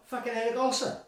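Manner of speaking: high-pitched punchline of a name; amazed reveal. Real, not acted. Storyteller revealing who came wandering over, amazed.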